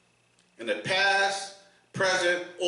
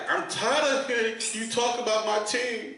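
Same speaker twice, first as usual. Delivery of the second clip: whiny coworker; 8 semitones higher than usual. Retold or imitated. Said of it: "he like".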